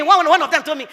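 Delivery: normal talking voice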